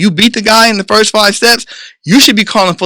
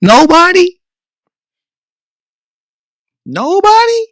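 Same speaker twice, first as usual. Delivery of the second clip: mock disbelief; 12 semitones higher, slower than usual. Mocking parent's claim.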